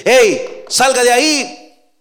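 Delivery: llamada fuerte y alta hacia dentro; grítale que salga de ahí; llamando a la puerta. Acted. (llamada fuerte desde fuera)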